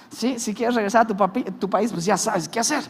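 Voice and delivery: ordinary talk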